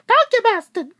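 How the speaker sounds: very high squeaky British yell; shrill mock yell of a British mom. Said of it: "her squeaky British voice".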